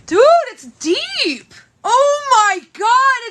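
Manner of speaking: real pain breaking into sobs; distressed, near tears; crying in pain. Real, not acted. Cut foot, crying in pain.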